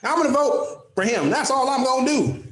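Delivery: high-pitched, exaggerated Southern accent; mock Southern accent. Radio host mocking lazy voters, in a put-on Southern accent. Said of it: "everybody I'm mad at has a Southern accent".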